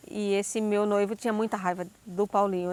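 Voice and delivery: ordinary talk, nothing marked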